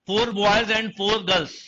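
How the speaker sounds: very slow, each word spaced out for writing; teacher reading out a question to copy down; slow dictation. Shown as a demonstration. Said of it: "अब एक और लिखिए".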